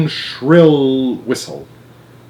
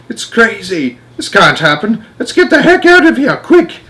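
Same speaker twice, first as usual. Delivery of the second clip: high, loud panicked yell; panicked men shouting; 9 semitones higher, 4 dB louder than usual. Acted. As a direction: shout it like terrified grown men fleeing.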